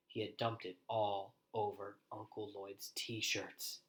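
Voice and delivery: ordinary delivery, nothing marked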